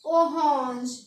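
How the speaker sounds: ओहोंज with a breathed French r and nasal vowel; French pronunciation of ओहोंज; French orange. Shown as a demonstration. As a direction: say ओहोंज as o-honzh. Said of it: "क्या पढ़ेंगे?".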